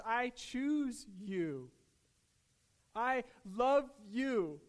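slow, deliberate words of love; slow, heartfelt declaration. Retold or imitated. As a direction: draw out each word, very slowly. Voicing God's tender declaration to believers.

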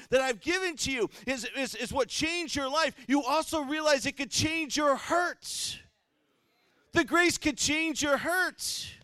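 loud, high preaching at full force; fiery sermon voice. Acted. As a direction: preach it to the back pews.

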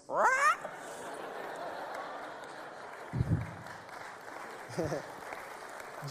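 high breathy laugh. Real, not acted. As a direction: laugh at the punchline. Laughs at own punchline.

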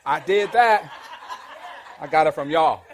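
bragging to Jason at work, loud laughing boast; loud playful brag. Pretend. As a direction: stand up and crow about it.

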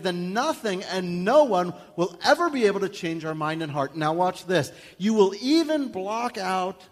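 high, loud, driving sermon; fired-up pulpit preaching; full-force preaching. Acted. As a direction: preach it hard to the congregation.

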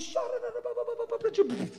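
high, rapid babble of syllables; imitated speaking in tongues. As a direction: rattle off tongues as the girl, high and fast. Imitates girl bursting into tongues.

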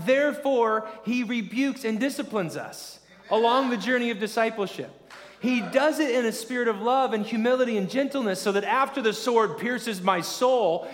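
high, loud and driving; full-force preaching. Acted. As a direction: preach it hard to the crowd.

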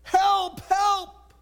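acted squeal, pitched well up; mock bird scream. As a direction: cry out as the trapped birds. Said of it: "the birds would scream".

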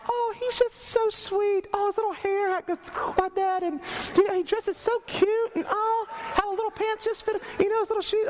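put-on high swooning girly voice; lady voice. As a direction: gush like a smitten girl. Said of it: "that's my lady voice".

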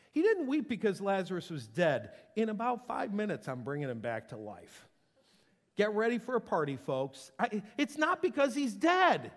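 loud, high, excited declaration; fervent preaching. Real, not acted. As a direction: shout the good news. Preaching build.

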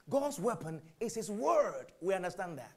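ordinary talk, nothing marked

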